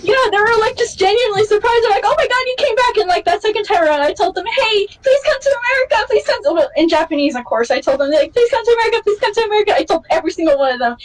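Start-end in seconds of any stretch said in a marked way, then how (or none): 2.1-3.0 high, delighted exclaim; light up, pitched high; mock thrilled idol. Idols see the fan return, high and delighted. 4.4-6.5 eager pleading. Retold or imitated. Fan begging idols to visit America, high eager plea. 8.3-9.8 high, earnest repeated plea; eager pleading. Retold or imitated. Fan pleading with each idol, high and earnest. Begging idols to tour.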